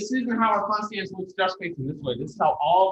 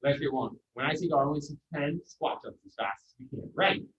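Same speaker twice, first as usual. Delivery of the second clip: ordinary talk, nothing marked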